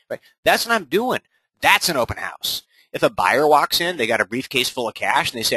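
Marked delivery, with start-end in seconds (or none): none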